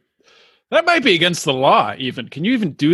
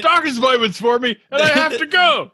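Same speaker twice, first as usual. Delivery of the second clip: mock complaint, pitched up high; annoyed neighbor; 6 semitones higher than usual. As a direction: play a lightly put-out neighbor.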